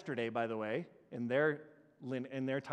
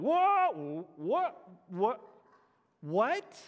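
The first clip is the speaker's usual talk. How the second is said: higher, louder stunned disbelief; shocked crowd; 6 semitones higher, 6 dB louder, much slower than usual. Retold or imitated. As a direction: act the crowd's startled double take. Crowd shocked at eating flesh.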